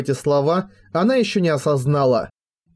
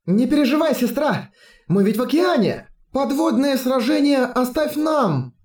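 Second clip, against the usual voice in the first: put-on high, confident girlish voice; cheerfully reassure, pitched up; playful high girls; 9 semitones higher than usual. Twins reassure sister playfully.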